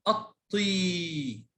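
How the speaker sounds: الطي with the final "ee" held long; slow, drawn-out الطي with long ya; long vowel. Shown as a demonstration. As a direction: stretch the ending of الطي, "at-Teee". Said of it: "مد الياء".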